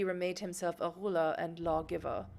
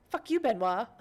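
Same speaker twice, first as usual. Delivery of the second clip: loud, higher playful yell at Benoit; mock outburst; 5 semitones higher, 7 dB louder than usual. Player yelling at Benoit who ran off, mock shout. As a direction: blast him for ditching you, half joking. Player cursing the fleeing companion.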